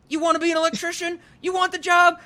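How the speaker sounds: high, fast, manic goofy shouting; goofy Tom Green voice. Retold or imitated. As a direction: ham it up like a wacky job interviewer. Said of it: "like, doing voices where he's like".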